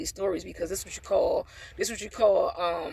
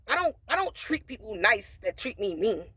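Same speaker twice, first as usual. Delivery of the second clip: ordinary delivery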